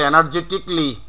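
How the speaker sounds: এনার্জেটিকলি said "e-nar-je-tik-li"; silent A. Shown as a demonstration. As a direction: drop the A in এনার্জেটিকলি, ending "-tikli". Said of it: "তাহলে".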